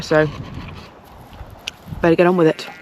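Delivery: ordinary talk, nothing marked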